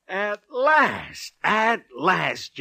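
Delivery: high, ringing cry of triumph; triumphant exclamation. Acted. Triumphant reveal to Candy.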